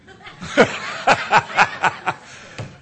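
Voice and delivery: loud breathy burst of laughter; hearty laugh. Real, not acted. Preacher amused by his own "mister" greeting, hearty laugh.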